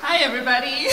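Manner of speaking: ordinary delivery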